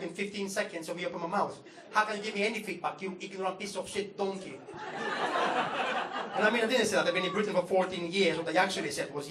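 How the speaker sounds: normal talking voice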